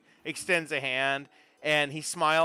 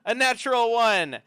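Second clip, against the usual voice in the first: high, loud announcement of a roll; excited reveal; 7 semitones higher, 6 dB louder than usual. Real, not acted. Game master reveals the goblin's roll, excited. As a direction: land the surprise for the table.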